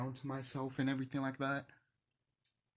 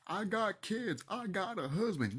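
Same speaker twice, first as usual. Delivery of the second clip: high, whiny mock of people's excuses; 8 semitones higher than usual. Mocking viewers who hide behind their kids.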